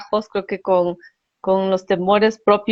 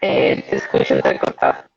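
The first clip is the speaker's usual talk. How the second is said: choppy robotic call audio; glitchy low robotic connection. Real, not acted. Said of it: "Yo le escucho un poco robóticamente".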